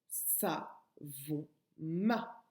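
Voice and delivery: savon ma. drawn out very slowly, each syllable held; slow demo. Shown as a demonstration. Teacher slowly saying the new nonsense word savon ma. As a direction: stretch out savon ma. so the children hear the syllables. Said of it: "si j'ajoute ma à la fin".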